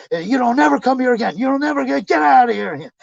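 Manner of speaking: high-pitched mock shrieking rant; retold screaming. Clerk behind closed window banning customer, high mock scream. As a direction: imitate her screaming him away. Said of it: "she's screaming and shit inside".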